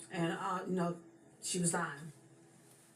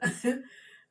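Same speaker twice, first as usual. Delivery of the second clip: fond laugh; 7 semitones higher, 5 dB louder than usual. Real, not acted. Fond memory of grandmother.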